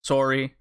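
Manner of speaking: Sori said as "SOR-ee"; variant pronunciation. Shown as a demonstration. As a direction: shorten Sori to two syllables, like "sorry". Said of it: "heard it pronounced a number of different ways".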